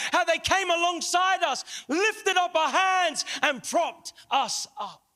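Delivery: swelling, fervent preaching; impassioned preaching. Real, not acted. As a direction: build the testimony into a preacher's fervent cry. Preaches at full force.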